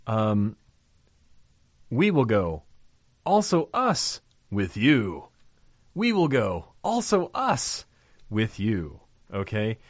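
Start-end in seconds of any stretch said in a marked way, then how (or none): 1.9-5.3 slow, weighted "also us", each word set apart; stressing also us. Shown as a demonstration. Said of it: "The Greek there reads". 5.9-9.0 "also us" lifted much higher and drawn out slowly; stressing also us. Shown as a demonstration. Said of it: "The Greek there reads".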